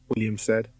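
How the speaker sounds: plain reading voice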